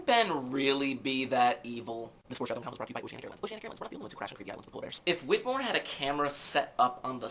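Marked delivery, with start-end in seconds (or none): 2.3-5.0 rapid-fire, softer disclaimer read; mock sponsor tag. Vlogger plugs a joke airline sponsor, rapid-fire ad read. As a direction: race through it like ad small print.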